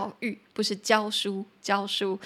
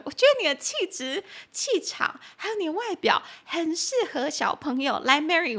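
much higher mock boss voice; imitating the boss; 7 semitones higher than usual. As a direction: play the boss coaxing, pitched way up. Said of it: "他是这样子说的". (高八度模仿补习班老板)

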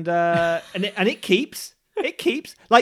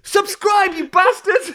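higher, louder playful yell; loud mock sign-off; 13 semitones higher, 8 dB louder, slower than usual. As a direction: shout it at the viewers.

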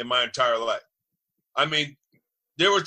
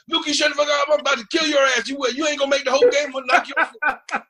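much higher, louder hammed-up shout with a laugh; mock threatening yell; 12 semitones higher, 5 dB louder, faster than usual. Zach yelling back at Luke, mock furious shout. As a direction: bark it back like a furious lineman, half laughing. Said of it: "he be like".

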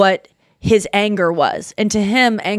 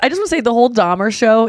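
normal talking voice

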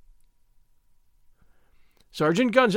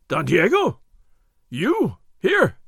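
gasped surprise; 2 semitones higher, 5 dB louder, slower than usual. A narrator's reading. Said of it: "Gonzalez gasped".

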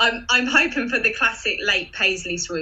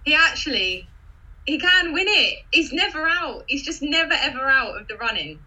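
enthusiastic; 6 semitones higher than usual. Real, not acted. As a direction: pitch jumps way up in eager excitement. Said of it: "Such enthusiasm".

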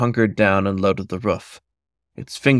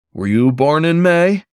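higher, louder urgent question; 7 semitones higher, 5 dB louder than usual. Acted. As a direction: blurt it out, suddenly alarmed. Sudden realisation.

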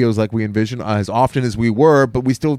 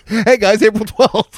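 mock announcer shout; 8 semitones higher, slower than usual. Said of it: "[name] is plugging us".

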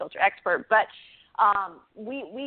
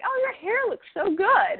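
much higher, bright gushing praise; gushing compliment; 14 semitones higher than usual. Retold or imitated. Wife complimenting her husband's hair, gushing high. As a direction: gush over his hair like you just noticed it. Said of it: "be like".

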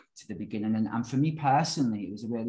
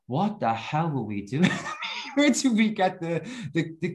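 retold disbelief; 6 semitones higher than usual. Looking back on jumping to Vegas, laughing disbelief. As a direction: draw it out, amused and incredulous.

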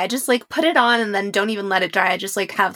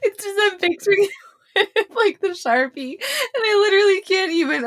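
high, helpless laugh-crying; 10 semitones higher than usual. Real, not acted. Host picturing Sharpie eyebrows, crying with laughter. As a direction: laugh so hard it turns to tears. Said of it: "not prepared to cry from laughing".

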